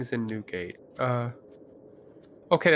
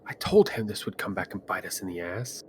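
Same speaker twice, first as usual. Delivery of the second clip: hushed under-breath grumble, looking away; whispered I-told-you-so; muttered aside; 6 semitones lower, 5 dB quieter than usual. Pretend. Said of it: "kinda look away and be like".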